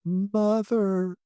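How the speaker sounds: half-hearted sleeper's wail; mock crying out. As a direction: call out as if tossing in sleep. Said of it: "crying out".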